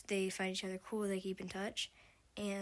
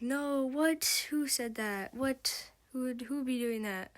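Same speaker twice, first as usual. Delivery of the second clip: loud, high, flustered denial; teen caught texting her crush, loudly denying it; 4 semitones higher, 5 dB louder than usual. Retold or imitated. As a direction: deny it too loudly, as if caught red-handed. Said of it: "Finch would be like".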